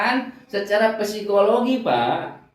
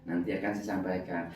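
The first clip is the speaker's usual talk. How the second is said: calm soothing reply; 9 semitones lower, 8 dB quieter than usual. Retold or imitated. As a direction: settle him down, fading softer to the end. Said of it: "Orang marah".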